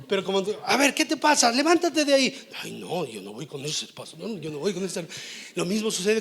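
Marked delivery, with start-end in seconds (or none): 0.7-2.5 loud, high-pitched telling-off; stern scolding. Acted. Pastor rousing a sulking church member, scolding. Pastor's rebuke, acted for congregation. 2.6-5.1 mock offended churchgoer, dropped in pitch; sulky refusal. Church member refusing that pastor, sulky.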